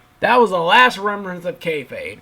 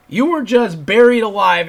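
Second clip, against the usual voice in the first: exasperated; 5 semitones higher, 7 dB louder than usual. Real, not acted. Ranting repeat, louder.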